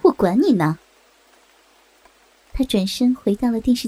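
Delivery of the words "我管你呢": dismissive retort, low with a swooping tone; a touch of contemptuous grumbling; disdainful grumble. Acted. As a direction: brush them off scornfully. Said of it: "嘀咕道".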